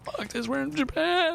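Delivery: very high, stretched-out holler; slow mock party cry; wild abandon. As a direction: imitate a reckless friend whooping it up. Acts out wild friend in car.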